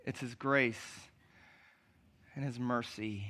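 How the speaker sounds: hushed, reassuring pastoral appeal; soft tender preaching. Acted. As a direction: speak gently to the hurting ones in the room. Quiet tender stretch of sermon.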